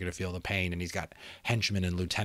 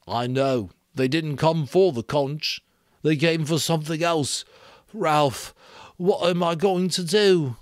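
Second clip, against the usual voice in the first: higher and louder, rising into a plea; despairing Piggy; 8 semitones higher, 7 dB louder than usual. Acted. Blinded Piggy pleading with Ralph, despairing. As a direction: beg for help, at a loss.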